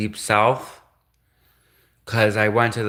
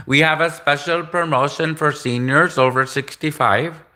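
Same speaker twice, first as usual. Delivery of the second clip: higher-pitched mock Indian sales caller; Indian accent; 4 semitones higher than usual. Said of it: "I can do an Indian".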